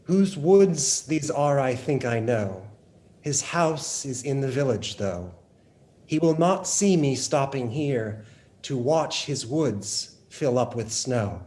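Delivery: slow, measured poem recital; poetry reading. Acted. Host reading a wintry Frost poem, measured recital. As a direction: read the verse aloud, lingering with pauses.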